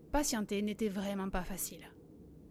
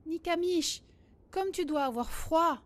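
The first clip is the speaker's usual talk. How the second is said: high-pitched, moderately raised storyteller's cry; calling out loudly; 6 semitones higher, 4 dB louder than usual. A narrator's reading. Boy shouting to the wind bird over the storm, calling out. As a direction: call out to someone over the wind. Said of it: "il cria aussi fort qu'il le put".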